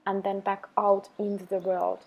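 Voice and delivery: calm, even meditation voice with long pauses; unhurried, level delivery for a self-love practice; guided meditation. Acted. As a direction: guide the listener gently into the breath, leaving space after each phrase.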